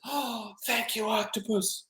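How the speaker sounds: soft, high, slow gratitude; touched little boy. Acted. Boy offered his favorite book, softly grateful. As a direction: coo it softly like a thankful child. Boy thanks octopus for story.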